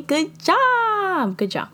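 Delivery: high, drawn-out praise; playful praise. Real, not acted. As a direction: sing out the praise in a high pitch. Playfully praising the audience for following.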